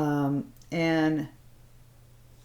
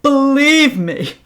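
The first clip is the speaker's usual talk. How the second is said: higher, louder, slow "Believe me"; hammed-up pun; 9 semitones higher, 11 dB louder, slower than usual. Shown as a demonstration. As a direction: ham up the brand pun. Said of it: "Get it?".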